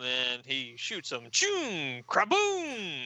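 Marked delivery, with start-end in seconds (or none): 1.3-3.1 drawn-out comic explosion noises; imitated blast. Cyborg shoots the drums, imitated explosion sounds. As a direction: launch the shot, then burst higher on the blast.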